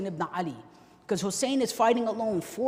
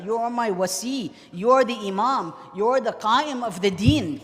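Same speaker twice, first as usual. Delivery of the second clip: loud, raised, grieving sermon voice; impassioned lament; 4 semitones higher, 6 dB louder than usual. Acted. Father and son parting before death, impassioned lament. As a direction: declaim each phrase with mounting grief.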